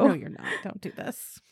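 unvoiced, pleading whisper; half-joking whispered plea. Real, not acted. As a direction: beg them softly to stop. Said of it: "whispered plea".

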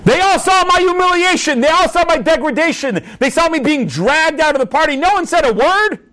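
high-pitched, acted-out indignation; outraged humiliation. Humiliated guest recalling rabbis who watched, outraged. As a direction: build a bitter accusation against silent onlookers. Humiliated man rages at silent witnesses.

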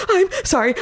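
high-pitched, tearful squeak; imitating a weepy high voice; mock sobbing apology. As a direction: apologize as if bursting into sobs, hammed up. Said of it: "started sobbing".

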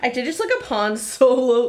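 ordinary delivery

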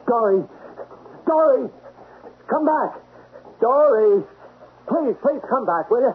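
high, pleading calls; calling out. Acted. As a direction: call her back across distance. Calling after fleeing girl.

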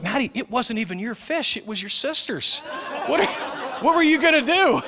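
incredulous dad cracking up; laughing disbelief. Retold or imitated. Father retells asking daughter why she ate sister's fish, laughing. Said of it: "I'm like".

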